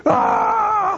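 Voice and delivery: sudden high yelp of pain, like a burn on sore gums; mock pain yelp. Telling the congregation about the donut, a high pained yelp. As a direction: yelp as hot glaze hits freshly cleaned gums.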